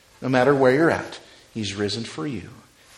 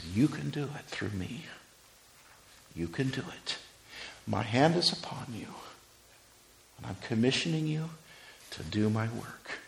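soft reassurance; 2 semitones lower, 8 dB quieter than usual. Acted. Jesus commissioning, voiced softly.

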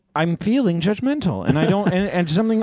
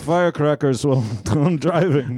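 put-on Russian accent. Russian bit continues.

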